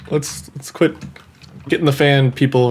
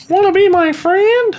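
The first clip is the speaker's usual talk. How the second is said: very high, squeaky plea; silly high voice. Pretend. Player courting a stranger, silly high voice. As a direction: pipe it up in a put-on little voice.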